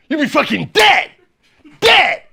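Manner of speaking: loud, high mock-dire outburst; explosive acted warning; shouted warning. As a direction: shout it to hammer the danger home. Acted passenger repeats dire warning.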